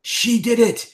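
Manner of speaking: high, loud frantic accusation; crazed accusation. Acted. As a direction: point the finger in a frenzy. Crazed prisoner accuses her.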